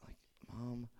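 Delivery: embarrassed whisper. Retold or imitated. Sons whispering to their mom before Jesus, embarrassed. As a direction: hiss it so nobody hears you're with Mom. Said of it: "And they're like".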